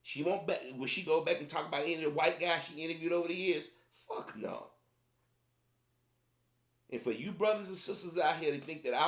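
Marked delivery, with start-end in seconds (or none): 4.1-4.7 breathy, dismissive under-breath no; whispered scoff. Real, not acted. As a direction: toss it off in a whisper. Scoffing aside to viewers.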